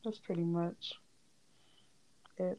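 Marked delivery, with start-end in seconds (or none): none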